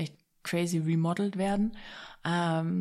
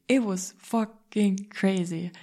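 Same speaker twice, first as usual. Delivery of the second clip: drawn out even slower and louder; exultant, slower; 4 dB louder, much slower than usual. Real, not acted. Telling the viewers about the deal, slowed-down exultation. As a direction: stretch it out triumphant.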